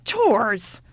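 put-on loud, irritable old woman; snapped. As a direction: snap back impatiently. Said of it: "snapped the old woman".

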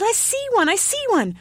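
put-on high, loud child's squeal; excited cry. A narrator's reading. Ugly child has spotted a Christmas tree, excited cry. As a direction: cry out, thrilled at the find. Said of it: "cried the ugly child".

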